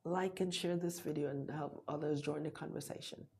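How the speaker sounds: ordinary delivery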